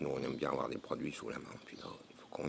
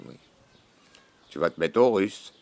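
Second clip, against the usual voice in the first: normal talking voice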